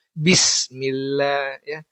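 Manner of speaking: Bismillah slow, with a clear b at the start; correct pronunciation. Shown as a demonstration. Teacher showing students the right way, ba sounded clearly. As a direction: sound the ba fully in Bismillah, slowly. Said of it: "harus dibunyikan".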